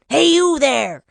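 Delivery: shouted hail from afar; old man's shout. A narrator's reading. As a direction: holler it like an old man. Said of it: "the old man shouted".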